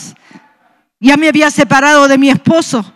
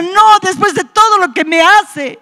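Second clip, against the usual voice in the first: high-pitched, aggrieved refusal; retold crying protest; 6 semitones higher than usual. Wife who cried all night over her husband, retold tearful protest. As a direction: refuse as if in tears, pitch raised throughout. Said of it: "Esa noche lloré tanto y decía".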